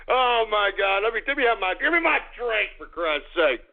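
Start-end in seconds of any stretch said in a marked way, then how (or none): none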